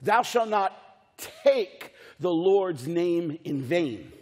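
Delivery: slow commandment with "take" isolated by pauses and stretched; pause, then land hard on "take"; deliberate recital, "take" held out on its own; stress on take. Shown as a demonstration. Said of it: "Jesus uses the same word".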